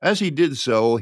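ordinary delivery

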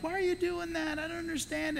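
high, soft puzzled parent; parent's puzzled question. Retold or imitated. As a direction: ask it like a baffled parent. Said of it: "my parents were like".

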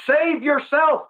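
high, slow frantic cry of save; panicked plea. Retold or imitated. Preacher voicing the thief's panic. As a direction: cry out in terror to be saved. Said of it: "he is in absolute panic".

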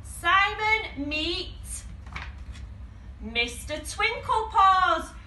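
high, loud, drawn-out announcement; grand introduction. Acted. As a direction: present the star candidate with a big build-up. Grand reveal of last candidate.

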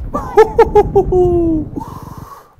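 high shrieking laugh; loud laugh. Real, not acted. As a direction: burst out laughing loudly. Big laugh after a kill.

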